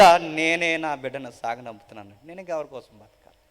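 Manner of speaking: a hint of a grieving mother; lightly voiced mourning, rising and falling; mother's lament. Preacher voicing the widow weeping behind the bier, mild lament. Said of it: "ఏమని ఏడుస్తా అని చెప్పండి".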